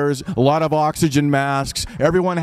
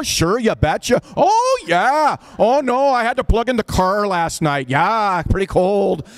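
put-on high, broad Minnesota nurse accent; hammed-up Fargo-style Minnesota accent, pitched high; 7 semitones higher than usual. Nurses from Minnesota chatting with patients, high Minnesota accent. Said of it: "because that's my accent. That accent goes from Minnesota".

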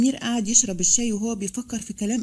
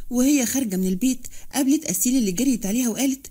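plain reading voice

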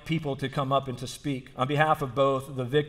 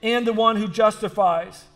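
raised, emphatic sermon voice; higher-pitched, insistent pulpit delivery; impassioned preaching; 7 semitones higher, 6 dB louder than usual. Real, not acted. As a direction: preach it with force, driving each phrase home. Preacher builds from story into sermon.

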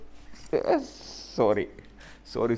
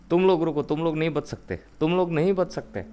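mock taunt; 6 semitones higher, 3 dB louder, faster than usual. Taunting zombies in a fight.

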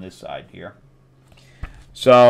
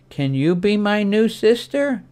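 put-on girl's voice; 9 semitones higher than usual. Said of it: "And she's like".

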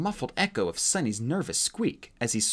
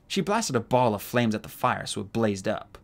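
ordinary delivery

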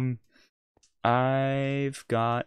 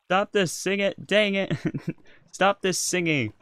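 mock shout; 8 semitones higher, 6 dB louder than usual. Playful outburst at singing friend.

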